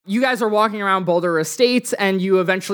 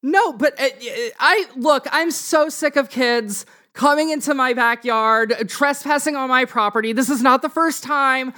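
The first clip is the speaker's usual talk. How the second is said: higher-pitched scolding neighbor; exasperated homeowner voice, pitched up; fed-up woman homeowner; 6 semitones higher than usual. Acted. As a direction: lecture trespassing kids in a raised pitch. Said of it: "this woman comes out".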